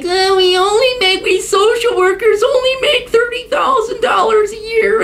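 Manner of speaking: very high whiny mock wail of a social worker; whining mock cry. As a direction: whine it like a crybaby. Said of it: "bitching, whining, and moaning".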